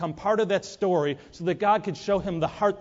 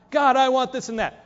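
eager demanding plea; 8 semitones higher, 5 dB louder than usual. Acted. Demanding prayer acted.

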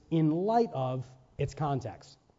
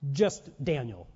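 usual speaking voice

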